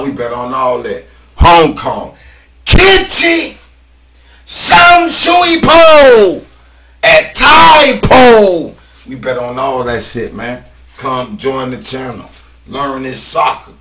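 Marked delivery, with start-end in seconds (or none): 2.6-8.8 high, loud, slow drawn-out team call; hype call. Real, not acted. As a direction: stretch each name like a stadium announcer. Calling out Hong Kong teams.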